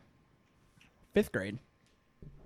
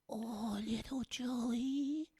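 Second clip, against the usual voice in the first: slow breathy granny whisper; whispered grandma; 2 semitones higher, 10 dB quieter, much slower than usual. Shown as a demonstration. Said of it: "Do a grandma".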